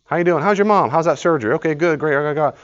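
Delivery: quick, high, breezy small talk; rapid chirpy greetings. Retold or imitated. Churchgoers trading hasty greetings, high and quick. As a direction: fire off hellos without listening.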